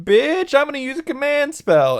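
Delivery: very high, hammed-up retort; exaggerated high-pitched squabbling; mock sassy threat. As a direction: fire back a threat in a squeaky-high voice. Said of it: "And then Rin's like".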